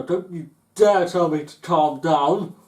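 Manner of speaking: loud, slow angry retort; don't you dare, said loud and slow; furious customer. Retold or imitated. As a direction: bark it back, outraged. Acted quarrel, customer retort.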